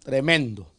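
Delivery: usual speaking voice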